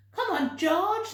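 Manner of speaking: high, loud eager urging; eager animals. A narrator's reading. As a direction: coax him excitedly. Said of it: "they said".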